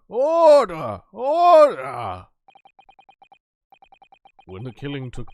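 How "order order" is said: loud, high judge's shout; shouting order. Acted. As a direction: roar for silence in court.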